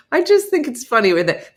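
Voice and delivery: ordinary talk, nothing marked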